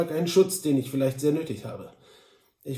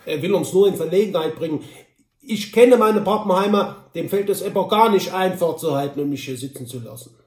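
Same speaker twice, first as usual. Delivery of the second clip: louder, higher Altenburg dialect; Altenburger Mundart; 4 semitones higher, 7 dB louder than usual. A narrator's reading. Droll shrugs off his cousin's threat in Altenburg dialect. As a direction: play him in a broad Altenburg Saxon dialect. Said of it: "antwortete Droll in seiner Altenburger Mundart".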